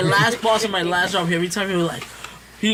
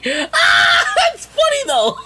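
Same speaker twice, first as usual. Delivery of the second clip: very high, loud laughing "that's funny though"; loud laughter; 8 semitones higher, 6 dB louder, much slower than usual. Real, not acted. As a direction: crack up and squeal through it. Bursts out laughing at news.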